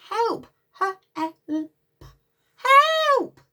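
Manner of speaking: high, loud rising cry; panicked cry for help. Acted. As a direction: call out in alarm for the pram. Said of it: "That lady is saying".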